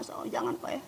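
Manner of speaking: a sudden hushed whisper. Real, not acted. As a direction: drop to a whisper to warn. Sudden hush in a sermon.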